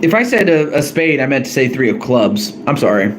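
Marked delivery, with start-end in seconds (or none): none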